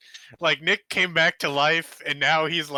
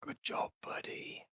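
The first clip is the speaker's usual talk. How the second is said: whispering; 18 dB quieter than usual. Acted.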